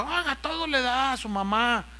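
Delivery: mimicking a complainer, high-pitched grumble; complaining. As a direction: whine it like a griping in-law. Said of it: "gente que viene y se queja".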